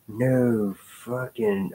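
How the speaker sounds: drawn-out biker gang voice; biker accent. Retold or imitated. Reading a biker's comic line aloud, biker accent. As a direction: stretch it slowly in a put-on biker accent. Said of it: "that accent because he looks like he's some kind of biker gang member".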